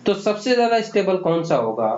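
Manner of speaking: ordinary talk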